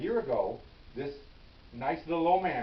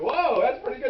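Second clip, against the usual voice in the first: much higher and louder, amazed; amazed excitement; 11 semitones higher, 10 dB louder than usual. Retold or imitated. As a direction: cheer at the lucky catch. Cops amazed at the arrest.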